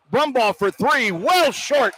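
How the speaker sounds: rising play-by-play call on the last shot; excited call. Real, not acted. Radio announcer calling the final shot, excited. Buzzer-beater call at halftime.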